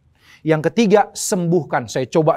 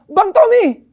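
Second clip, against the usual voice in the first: high, loud, jubilant calling; imitating an overjoyed friend shouting the news; retold beaming joy; 7 dB louder than usual. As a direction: call out proudly to show off first steps. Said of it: "muka yang begitu berseri-seri dan bilang".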